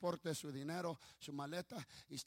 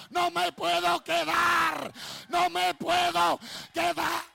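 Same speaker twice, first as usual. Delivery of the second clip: drawn-out, high, very loud cry; screaming demon; 12 semitones higher, 17 dB louder, slower than usual. Retold or imitated. Demon driven out by baptism, imitated long scream. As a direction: wail it at full volume as the defeated demon. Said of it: "el demonio gritó y dijo".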